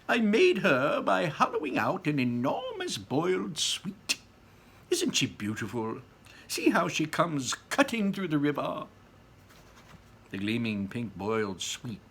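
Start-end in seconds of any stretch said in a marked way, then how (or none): 0.1-8.9 high, louder delighted showman; proud Wonka. A narrator's reading. Wonka boasts to the visitors, high and delighted. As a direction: boast about the sweet with glee. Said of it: "beaming with pleasure".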